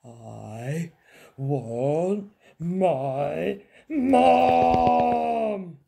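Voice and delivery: drawn-out wail swelling to a loud Mum; crying out. A narrator's reading. Princess with a banged knee, crying out. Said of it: "cried the little princess".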